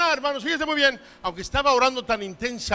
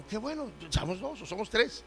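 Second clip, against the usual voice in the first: ordinary talk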